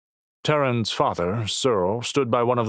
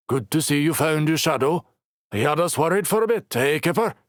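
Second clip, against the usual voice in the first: playful teasing; 8 semitones higher, 3 dB louder than usual. Acted. Logan teasing the little girl.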